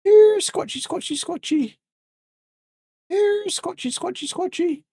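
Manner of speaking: very high falsetto coaxing call; playful creature call. Pretend. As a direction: call it like a pet in a tiny high voice. Calling a creature in the game.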